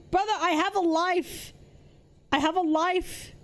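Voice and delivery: high indignant whine; exasperated whine. Real, not acted. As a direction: protest it, whining high. Protests being told to work.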